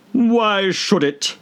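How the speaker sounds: a slow muttering Hatter. Acted. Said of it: "muttered the Hatter".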